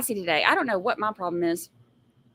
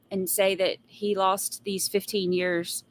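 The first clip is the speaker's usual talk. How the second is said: ordinary talk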